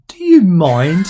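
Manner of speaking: loud, slow, mock-offended; a loud playful telling-off for the interruption; slow, mock-outraged "do you mind"; mock indignant. Real, not acted. Interrupted mid-monologue by co-host.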